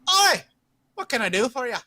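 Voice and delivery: put-on high, bright-pitched boy hailing a customer, loud at first; young innkeeper lad. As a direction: pipe up as a teenage lad, opening with a shout. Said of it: "this kind of young looking".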